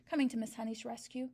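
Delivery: plain reading voice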